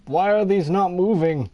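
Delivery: high annoyed question; puzzled and irritated; frustrated. Real, not acted. Frustrated when objects fail.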